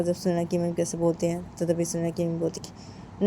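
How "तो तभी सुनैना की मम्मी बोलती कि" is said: ordinary delivery, nothing marked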